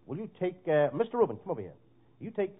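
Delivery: ordinary talk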